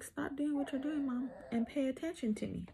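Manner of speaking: a hint of a high little baby voice; voicing the baby. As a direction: speak for a baby wanting mom's attention. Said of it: "She's like".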